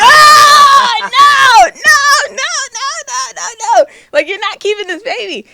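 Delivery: loud, high-pitched string of shouted no's; horrified protest. Retold or imitated. Imitating siblings hearing she'll keep the baby, hammed-up shouting. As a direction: shriek the refusal, then ease into insisting.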